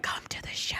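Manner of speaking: sneaky whispered plug. Acted. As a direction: hush it out, retreating.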